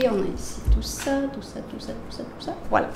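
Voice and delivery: murmured to herself while shuffling; whisper. Real, not acted. Tarot reader whispering over the cards she spreads. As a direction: breathe the words under your breath.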